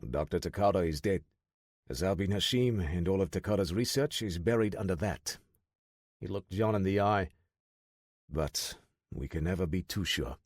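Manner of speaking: a weary, solemn officer voice; grave French commander Gavreau. Acted.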